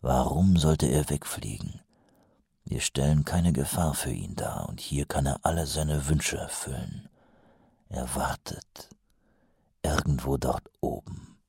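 low, quiet, brooding captain; Vimes brooding. A narrator's reading. (leise grübelnder Hauptmann)

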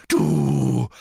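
loud, drawn-out outburst; enraged. A narrator's reading. Brother lunges at his taunting sister, enraged. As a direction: bellow the single word.

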